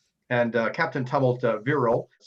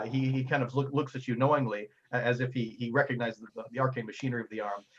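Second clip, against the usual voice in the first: ordinary talk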